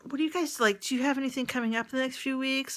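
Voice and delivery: high friendly invite. Retold or imitated. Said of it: "you call them up and be like".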